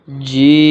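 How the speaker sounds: long, steadily held جیم; جیم stretched long. Shown as a demonstration. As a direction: draw out the vowel of جیم for about three beats. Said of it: "تین الف کے برابر کھینچ کر پڑھیں گے". (جیم کو تین الف کے برابر کھینچ کر)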